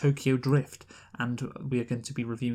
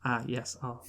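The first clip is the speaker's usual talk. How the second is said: ordinary talk, nothing marked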